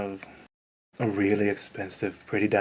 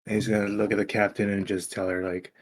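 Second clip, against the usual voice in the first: ordinary talk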